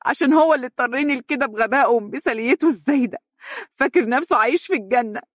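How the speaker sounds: high, fast tearful cry; weeping woman, blaming him; crying outburst. A narrator's reading. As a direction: sob it out bitterly. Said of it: "هتفت باكية".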